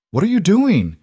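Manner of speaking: high, loud cry of alarm; shocked outburst. A narrator's reading. Lydia as Rowan tears the telegram, a shocked loud cry. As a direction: jump up in pitch, caught off guard.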